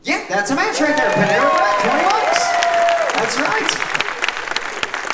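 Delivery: loud, high excited match call; game show host. Acted.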